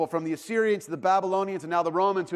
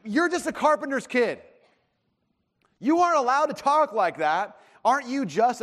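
mock sneer, higher and louder; sneering put-down; 7 semitones higher, 5 dB louder than usual. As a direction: jeer at him for overstepping. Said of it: "But, you know, we".

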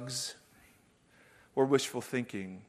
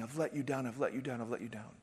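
retold sobbing; 3 semitones lower, 9 dB quieter, faster than usual. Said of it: "he just starts sobbing".

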